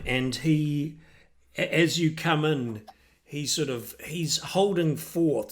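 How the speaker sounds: ordinary talk, nothing marked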